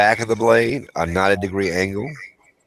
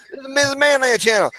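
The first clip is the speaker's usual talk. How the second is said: "This is Missus Manland channel" yelled much higher in fury; high, heated "This is Missus Manland channel"; pissed off. Real, not acted. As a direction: fling "This is Missus Manland channel" out as an angry jab. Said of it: "I'm pissed off at these damn people".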